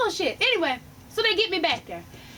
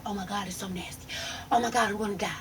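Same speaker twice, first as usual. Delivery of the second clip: whispering, lower, almost no voice; whispered dread; 9 semitones lower, 7 dB quieter, faster than usual. Real, not acted. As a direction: breathe it out like you might gag. Disgusted by vomiting sounds.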